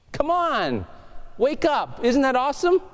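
bright pleading call to wake up; rousing the crowd. Real, not acted. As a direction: jolt the sleepy room awake. Preacher rousing sleepy congregation.

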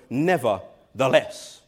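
"nevertheless" drawn out slowly with a rise; emphatic nevertheless. Shown as a demonstration. Preacher showing how Jesus stressed "nevertheless", slow and firm. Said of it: "put the emphasis right there".

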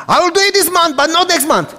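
high, loud giving-in imitation; mock cornered landlord. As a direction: give up, pitched high like the cornered man. Landlord concedes partially.

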